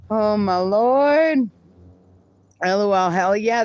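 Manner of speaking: usual speaking voice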